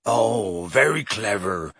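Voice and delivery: low, grudging growl. A narrator's reading. Hagrid concedes Harry's point, growling. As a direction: grumble it low in character. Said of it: "growled Hagrid".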